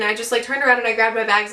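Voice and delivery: ordinary talk, nothing marked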